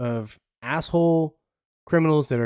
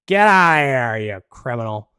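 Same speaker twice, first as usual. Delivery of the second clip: mock border rebuff; 7 dB louder than usual. Border agent turning away a criminal, loud mock dismissal. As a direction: shoo them off with a big loud mock order.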